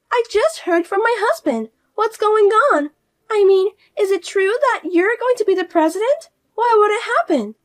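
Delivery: high, loud, shrill disbelief; can't believe what she heard; alarmed sister-in-law. Acted. Fiona confronting her sister-in-law about the presidency, high and alarmed.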